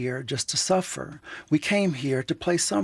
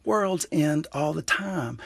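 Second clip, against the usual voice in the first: slow emphatic; 3 semitones higher, slower than usual. Real, not acted. Weighty point about worlds ending.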